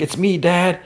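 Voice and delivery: high-pitched mock yell, only normal volume; retold yelling. Will calls to his dad with the rifle, imitated yell. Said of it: "Will's yelling".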